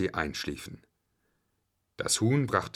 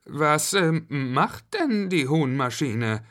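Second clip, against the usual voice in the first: lightly voiced child, higher pitch; a hint of a puzzled kid; Benjamin asking; 7 semitones higher, slower than usual. A narrator's reading. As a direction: ask in a raised, hesitant child's voice. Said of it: "fragte Benjamin".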